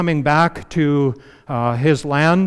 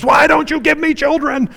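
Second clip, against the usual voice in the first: much higher, sharp demanding cry; Rachel's angry demand; 12 semitones higher, 4 dB louder than usual. Retold or imitated. Rachel, barren, confronting Jacob, high angry demand. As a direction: demand it of him in mock anger. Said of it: "she got mad at him and says".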